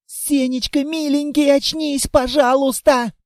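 put-on a small girl pleading for him to wake; pleading little girl.

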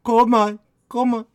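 put-on high, cooing accented coaxing; doting feeder. Killer feeding dead Anna a carrot, high coaxing voice. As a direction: coax a child to eat in a high, hammy accent. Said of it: "feed them as if they were still alive".

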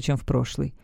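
usual speaking voice

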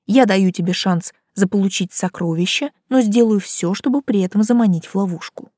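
ordinary delivery

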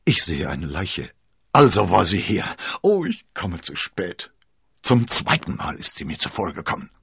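a lightly voiced agitated nobleman calling out; exclaiming. A narrator's reading. Lord Winter bursting in on the mourners, dismayed. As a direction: burst in dismayed, too late.

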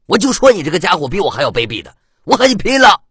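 high, loud roar building to a charge; furious shout. A narrator's reading. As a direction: explode and go all out at him. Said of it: "魏琛大喝一声". (气急败坏地高声大喝)

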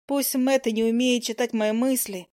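ordinary delivery

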